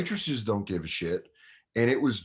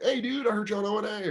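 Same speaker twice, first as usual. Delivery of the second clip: high, imitated fan voice; eager fan; 12 semitones higher, faster than usual. Fans tell the comic they heard him, eager. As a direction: hail a comic you recognize, pitched high.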